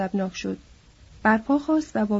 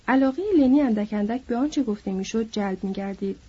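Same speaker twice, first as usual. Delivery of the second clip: ordinary delivery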